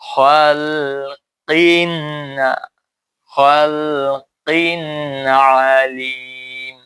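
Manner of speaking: খালকিন আলিম very slow with clear n; slow tajweed demo of খালকিন আলিম; clear izhar recitation. Shown as a demonstration. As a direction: recite খালকিন আলিম slowly, sounding the n plainly. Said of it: "তানবিন নুনটা স্পষ্ট করে পড়বে".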